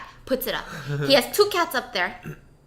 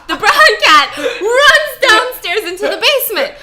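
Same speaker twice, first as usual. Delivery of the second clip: loud, high, laughing through the words; 8 semitones higher, 11 dB louder, slower than usual. Real, not acted. Telling a friend the story, laughing out loud. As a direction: crack up while telling the cat runs off.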